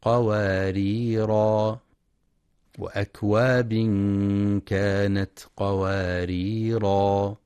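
قواريرا stopped on a long final a, slow; slow قواريرا ending in a; alif kept at pause. Shown as a demonstration. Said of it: "لإثبات الألف وقفا".

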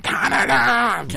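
high, slow excited shout; all caps shout. Retold or imitated. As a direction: belt it out like a text in all caps. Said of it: "Full caps".

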